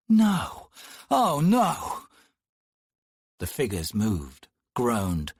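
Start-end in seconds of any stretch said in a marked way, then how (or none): 0.1-2.1 sharp high cry of dismay, a bit louder; startled gasped no; horrified cry. Acted. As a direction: recoil in horror at the sight. Horror at the writhing bodies.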